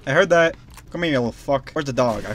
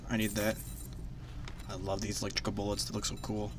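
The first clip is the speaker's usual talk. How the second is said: soft muttered admiration; 6 semitones lower, 13 dB quieter, faster than usual. Real, not acted. Quietly admiring new item.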